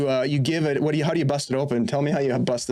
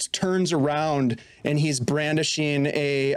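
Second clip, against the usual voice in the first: ordinary talk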